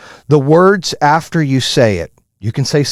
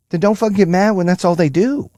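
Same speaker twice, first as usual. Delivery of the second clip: normal talking voice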